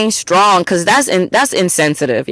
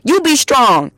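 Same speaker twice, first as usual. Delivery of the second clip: higher-pitched irritated clapback; imitated fed-up retort, pitched up; retold snapping back; 6 semitones higher, slower than usual. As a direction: act out snapping at someone. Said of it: "I had to snap on somebody".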